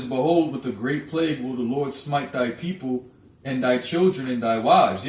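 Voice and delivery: loud, forceful preaching of the verse; scripture preaching. Acted. Warning listeners of the coming plague, preaching loudly. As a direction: thunder the warning from the pulpit.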